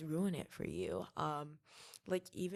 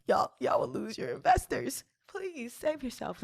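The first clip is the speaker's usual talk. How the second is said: laughing; 7 semitones higher, 7 dB louder than usual. Retold or imitated. Mock warning to art classmates.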